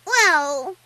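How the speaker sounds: put-on high, loud child's hesitation; hesitant little filly. A narrator's reading. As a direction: stall like a cornered little kid. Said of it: "Twilight stuttered".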